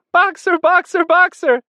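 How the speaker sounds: very high, loud calling; desperate repeated cry. A narrator's reading. Clover chasing the van, crying out frantically. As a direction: cry the name over and over. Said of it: "she cried".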